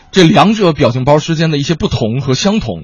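normal talking voice

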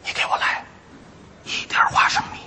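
hushed, halting customer's order; furtive, breath-only ordering; secretive whisper. Pretend. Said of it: "小声嘀咕着说".

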